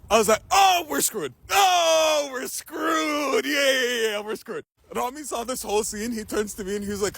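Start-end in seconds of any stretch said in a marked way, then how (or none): 0.5-4.6 high, drawn-out wail of doom, sinking at the end; mock despairing wail. Retelling own dread at being caught.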